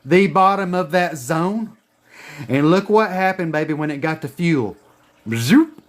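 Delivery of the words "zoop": a quick whooshing zoop; zoop sound. Pretend. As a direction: fire it off like a rocket. Price shoots up.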